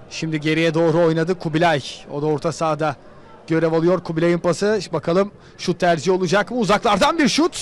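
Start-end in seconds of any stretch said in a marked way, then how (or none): none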